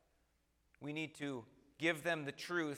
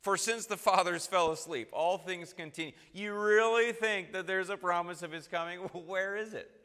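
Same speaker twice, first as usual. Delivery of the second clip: higher, louder ridicule with a laugh; mocking scoffer; 4 semitones higher, 5 dB louder than usual. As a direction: laugh at the believer's hope. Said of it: "It's ridicule".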